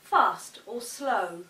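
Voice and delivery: slow "fast or slow" demo; fast then slow. Shown as a demonstration. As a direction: draw the words out. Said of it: "We can alter the pace".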